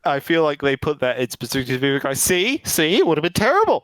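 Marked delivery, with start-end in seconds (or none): 2.2-3.8 high-pitched mock "told you so"; mock vindication. Filmmakers defending the missing accents to viewers, mock vindication. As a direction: play at being proved right, pitch jumping up. Voicing filmmakers justifying their choice.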